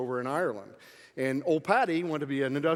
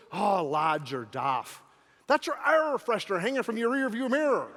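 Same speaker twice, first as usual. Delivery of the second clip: amused policeman; 5 semitones higher than usual. Acted. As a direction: chuckle it out to a foolish friend. Constable teasing his drunk friend.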